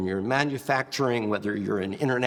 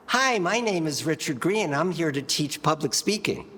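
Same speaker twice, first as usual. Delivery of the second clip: high-pitched, thin, lacking resonance; 6 semitones higher than usual. Shown as a demonstration. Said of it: "Very thin".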